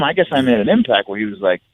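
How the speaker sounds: ordinary delivery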